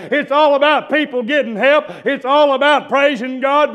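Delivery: loud, high revival preaching; full-force preaching. Real, not acted. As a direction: build to a hollered sermon cadence.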